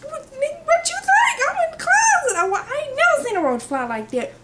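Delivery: very high, squealed protest; mock frantic yell. Retelling her answer from the closet, high frantic yell. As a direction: shout back in exaggerated panic.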